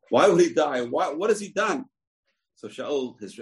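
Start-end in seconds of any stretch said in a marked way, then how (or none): 0.1-1.9 indignant protest. Acted. Son protests to his father.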